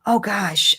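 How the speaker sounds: usual speaking voice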